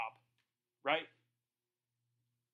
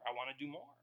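I wanna do more, repeated much softer; softly echoed I wanna do more; quieter repeat; 7 semitones lower, 8 dB quieter, faster than usual. Shown as a demonstration. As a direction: let the repeat drop to an earnest hush. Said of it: "And then I wanna do more".

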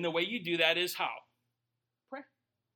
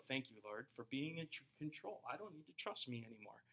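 much softer, grateful hush; soft prayer; 5 semitones lower, 11 dB quieter than usual. Retold or imitated. Believer thanking God for taking control, soft. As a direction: pray it quietly. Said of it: "You go".

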